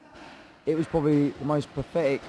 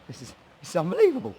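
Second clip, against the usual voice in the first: excited disbelief; 7 semitones higher, 3 dB louder, faster than usual. Retold or imitated. Spotting the fighter in shops.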